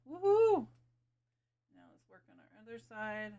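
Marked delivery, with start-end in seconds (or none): 0.1-0.7 high happy woohoo; excited cheer. Real, not acted. Cheering at good stroke.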